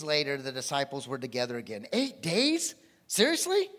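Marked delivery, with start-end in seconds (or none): 1.9-3.7 high, loud disbelief; incredulous. Real, not acted. Preacher to congregation, incredulous high loud. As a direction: throw the question out in disbelief. Preacher mocks the long wait.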